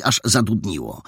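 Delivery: usual speaking voice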